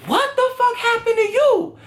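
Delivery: high, shrieking shock at the door; shocked sister. Retold or imitated. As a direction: voice the sister, aghast.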